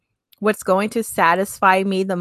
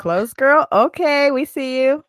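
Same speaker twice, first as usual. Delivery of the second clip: playful high tease; teasing; 5 semitones higher than usual. Real, not acted. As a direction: tease her, sing-song and high.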